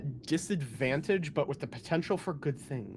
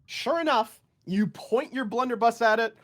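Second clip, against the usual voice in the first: dramatic scene narration; 6 semitones higher, 5 dB louder than usual. Acted. GM describes attack result.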